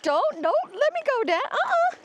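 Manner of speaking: high, whiny voice for the dog; voicing the dog. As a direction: speak the dog's thoughts in a whimpering voice. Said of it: "She's like".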